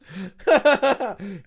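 loud burst of delighted laughter; laughing. Retold or imitated. Laughing at the memory of the Sullivans, loud laugh. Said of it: "Just laughing at the memory of it".

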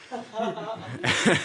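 high, giggly laugh; amused high-pitched chuckle. Real, not acted. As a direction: break into a short high laugh. Amused telling of flooring it.

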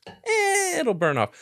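carefree shrug. Shown as a demonstration. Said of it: "That's, that's how I live my life".

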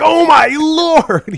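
very high, loud, slow; hammed-up shout. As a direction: scream it in full mock panic. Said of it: "and he's like".

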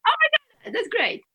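thrilled her interviewer loved the same book, excited. Real, not acted. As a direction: squeal it out with genuine joy. Delighted reaction to shared book love.

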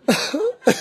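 a sudden high burst of real laughter. Real, not acted. Retelling the wedding fart story, bursting into laughter. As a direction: break into a giggle at the memory. Recalling the farting man.

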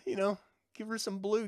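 ordinary talk

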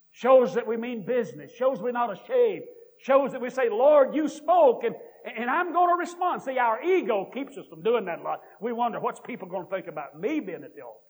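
loud, high altar-call preaching; rising revival-preacher appeal; preaching at full force. Acted. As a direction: press the invitation home with fervour.

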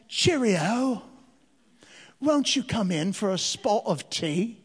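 hammed-up British tea invitation; drawn-out mock British accent. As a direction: imitate children playing at British manners. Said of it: "talk to each other with a British accent".

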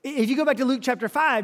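ordinary delivery

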